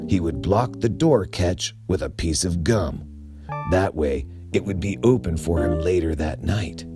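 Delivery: usual speaking voice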